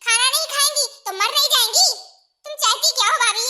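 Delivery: loud, indignant challenge; angry confrontation. Acted. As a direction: argue hotly with a sister-in-law. Angry quarrel about feeding mother. (गुस्से में ज़ोर से बहस करना)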